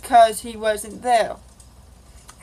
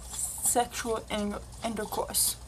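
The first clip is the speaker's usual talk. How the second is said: embarrassed hush; 12 dB quieter, slower than usual. Real, not acted. Embarrassed confession to viewers.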